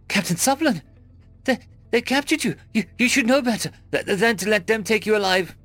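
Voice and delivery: high, stammering disbelief; high-pitched, halting alarm; distressed captive. A narrator's reading. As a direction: stumble over the words in a high, panicked voice. Terrified captive recognizes captured captain.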